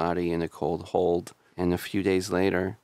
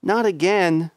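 much higher, louder melodramatic cry; mock anguished wail; 12 semitones higher, 7 dB louder than usual. Frank finds his buddy's corpse, a high hammed-up wail. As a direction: wail it like an overdone soap hero. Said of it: "and he's like".

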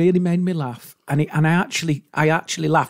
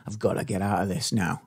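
low, hushed private thought; mum's secret urge to escape, low; imitated inner voice; 12 semitones lower, 8 dB quieter than usual. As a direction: think it under your breath, low. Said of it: "now in her brain, she's going".

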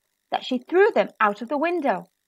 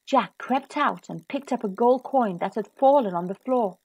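ordinary delivery, nothing marked

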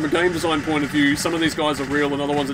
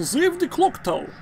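put-on Russian accent; 11 semitones higher than usual. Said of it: "Russian chick say".

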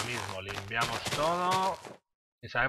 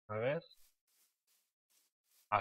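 ordinary talk, nothing marked

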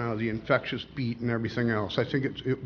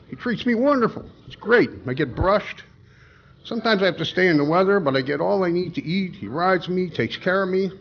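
high gushing talking-horse voice; 6 semitones higher than usual. Acted. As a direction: pitch way up, gushing like a pampered horse. Joke: the horse talks.

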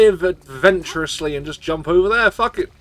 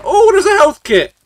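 excited; 12 semitones higher, 8 dB louder than usual. Real, not acted. Spots an item in the game.

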